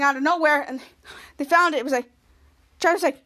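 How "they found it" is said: usual speaking voice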